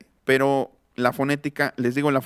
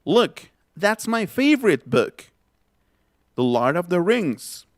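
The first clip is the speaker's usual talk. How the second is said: higher, slow, every word set out clearly; slow model phrase; 3 semitones higher, slower than usual. Shown as a demonstration. Teacher models a phrase for students, slow and high. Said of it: "Otra vez".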